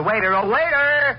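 slow and loud, summoning someone far off; calling across the room. Acted. Father in a restaurant calling the waiter back, loud and slow.

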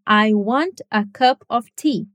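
each word said separately and slowly, "I want a cup of tea"; slow textbook English; careful clear English. Shown as a demonstration. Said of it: "falar assim claramente".

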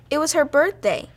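usual speaking voice

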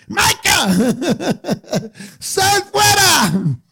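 a sister playing God to wake her brother; hammed-up booming wake-up call with giggles. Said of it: "ella viene con la voz de Dios".

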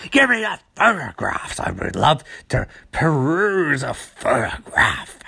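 mock-polite villain, dropping low at the end; put-on villain voice. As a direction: purr the request like a smug villain.

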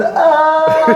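high, drawn-out "aah"; mock film scream. Podcast guest imitating people screaming in films, mock scream. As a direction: imitate a movie victim's scream. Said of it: "hvor de råber sådan".

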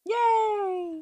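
high squeaky celebratory "Yay!"; mock cheer. Real, not acted. Cohost cheering the upcoming pickle topic, very high.

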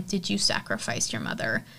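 a low, soft menacing question; menacing daughter. Retold or imitated. Izzy accusing her mom, low and menacing.

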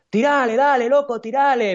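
high-pitched Argentine street cheer, imitated; imitated Argentine accent, high and drawn out; Argentine shout. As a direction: call out like an Argentine egging on a runner. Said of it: "había dos chicos argentinos".

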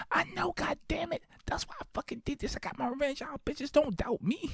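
high put-on voice, half-whispered brag; hammed-up gloating. Answering the friends in the bar, high gloating brag. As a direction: rub their noses in it.

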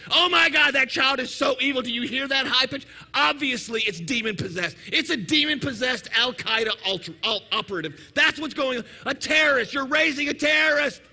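loud, hammed-up horrified indignation; judgmental outrage. Shopper judging another's crying child, acted escalating outrage. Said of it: "you're like".

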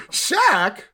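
put-on high, innocent child's voice; kid voice. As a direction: ask like a clueless little kid. Kid asking dad about Shaq.

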